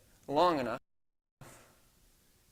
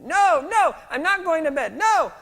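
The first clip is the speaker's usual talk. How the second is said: much higher, louder mock child wail; screaming toddler; 15 dB louder than usual. Sleepy daughter refusing dad, high mock child screaming. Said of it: "Ruthie starts screaming".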